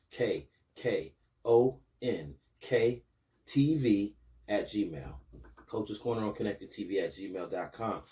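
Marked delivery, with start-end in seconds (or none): 0.1-5.1 spelled out slowly, letter by letter; slow down and spell it carefully for the viewer. Shown as a demonstration. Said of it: "I'll slow that down for you".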